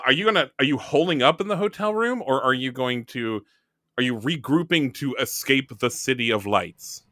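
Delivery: ordinary delivery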